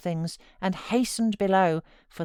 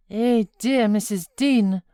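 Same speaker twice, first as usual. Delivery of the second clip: a higher, louder cry of greeting; exclaiming Zillah; 5 semitones higher, 8 dB louder than usual. A narrator's reading. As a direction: call out in surprise to an old friend. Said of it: "she exclaimed".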